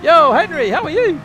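very high, loud excited hello; excited greeting. Real, not acted. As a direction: shout a thrilled greeting to a friend. Greets an arriving viewer loudly.

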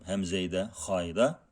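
usual speaking voice

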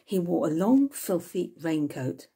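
usual speaking voice

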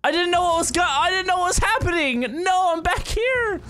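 very high frantic wail; panicked squeal. Real, not acted. Player sent back in the level, wailing in panic. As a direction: squeal in dismay, stretching the end.

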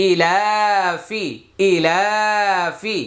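ইলাফি with long ই and লা, quick ফি; one-alif stretch. Shown as a demonstration. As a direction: draw out ইলা, release ফি quickly. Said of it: "ই এক আলিফ টান".

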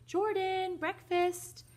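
high, loud singsong call; calling upstairs. Acted. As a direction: call the household to breakfast from downstairs. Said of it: "called upstairs to her husband".

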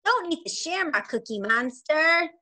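usual speaking voice

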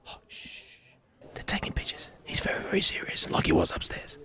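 hushed, breathy whisper so as not to disturb the shoot. Real, not acted. Host whispering beside a serious photo shoot, whispered. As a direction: drop to a real whisper, keep it secret. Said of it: "Why are you whispering".

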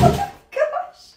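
real startled laugh. Real, not acted. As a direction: burst of laughter into a high squeal. Bucket mishap while cleaning.

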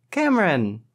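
high, delighted greeting; joyful exclamation. A narrator's reading. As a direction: exclaim the name with joy, pitched high. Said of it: "she exclaimed joyfully".

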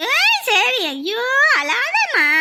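high, loud, drawn-out soothing; anxious coaxing over a baby's wails; pleading comfort. Acted. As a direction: stretch out a pleading 'don't cry'. Pleading with the crying baby.

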